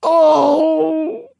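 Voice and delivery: high, loud anguished moan. A narrator's reading. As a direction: let out a crying moan. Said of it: "The ghost moaned".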